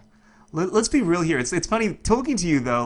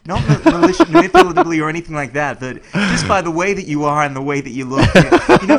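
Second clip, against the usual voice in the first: laughing; 3 semitones higher, 11 dB louder than usual. Real, not acted. Laughs while clarifying his joke.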